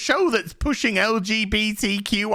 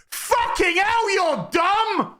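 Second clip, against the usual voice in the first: mock outraged yell; 10 semitones higher, slower than usual. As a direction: yell it up high like a furious scold. Character scolds in retelling.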